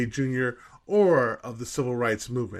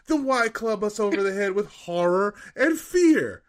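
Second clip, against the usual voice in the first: mock indignant rant; 7 semitones higher than usual. Reading the angry reviewer aloud to co-hosts, mock indignant. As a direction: play the reviewer's outrage for laughs, building to the end.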